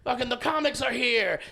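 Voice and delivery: high, loud party cheer; excited shout. Retold or imitated. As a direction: whoop it like a rowdy crowd. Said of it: "they're just all".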